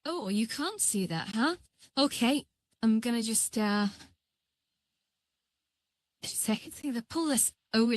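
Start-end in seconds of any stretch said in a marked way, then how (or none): none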